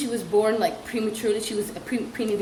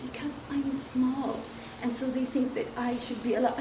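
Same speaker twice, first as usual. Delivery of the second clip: put-on soft child voice; 10 dB quieter than usual. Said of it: "She went".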